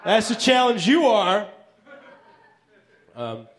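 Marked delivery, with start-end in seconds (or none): 0.0-1.5 higher-pitched, mock-cocky boast; cocky bluff. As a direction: play off the mistake with swagger. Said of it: "I'll play it off".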